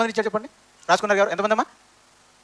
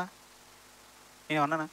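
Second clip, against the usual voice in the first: slow, much softer broken repeat of నేనేమన్నా అన్నానా; grieving repeat; 3 semitones lower, 11 dB quieter, much slower than usual. Acted. David hunted in the hills asking why, slow soft anguish. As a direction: let the question fade out in grief. Preacher voices David's lament.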